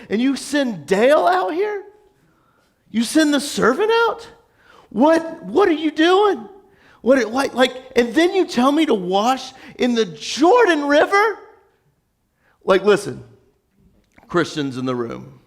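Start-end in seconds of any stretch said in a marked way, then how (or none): 0.1-11.4 high-pitched, disbelieving mock protest; acted-out outraged disbelief; incredulous indignation. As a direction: fire off offended questions, voice way up. Acting Naaman's outraged paraphrase.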